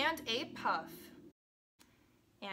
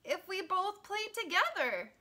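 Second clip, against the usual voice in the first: higher-pitched storybook character voice; lightly voiced hopeful dog; 8 semitones higher than usual. A narrator's reading. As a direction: voice a little dog making a suggestion.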